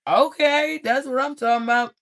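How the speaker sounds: high and loud, quick, thrilled; excited cheer. Real, not acted. Tarot reader cheering the collective's love reading, high and excited.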